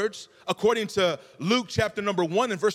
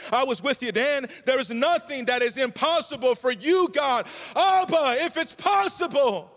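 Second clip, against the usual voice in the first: high-pitched preaching, swelling to a loud cry; building impassioned plea; 6 semitones higher, 4 dB louder than usual. Acted. Preacher voicing Jesus recalling miracles to God, building impassioned plea. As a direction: plead upward in waves until you cry out.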